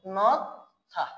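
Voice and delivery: নথা very slowly, long gap before থা; slow pronunciation model. Shown as a demonstration. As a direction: stretch the first syllable of নথা, pause, then থা. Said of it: "কি হয়ে যাবে?".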